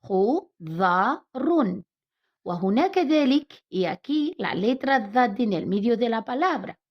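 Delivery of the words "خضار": خضار drawn out very slowly, each sound clear; slow model word. Shown as a demonstration. Teacher models خضار slowly for pupils. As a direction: stretch خضار syllable by syllable. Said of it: "Vamos a leer la palabra".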